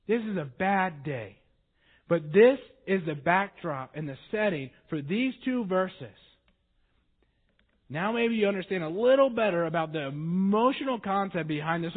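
ordinary talk

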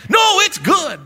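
high shouted mock cheer; cheery barber. Preacher plays the barber, high shouted cheer. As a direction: crow it like a beaming barber. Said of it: "barber's reply in sketch".

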